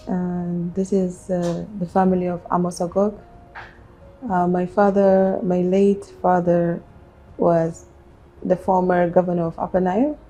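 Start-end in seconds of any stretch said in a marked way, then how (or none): none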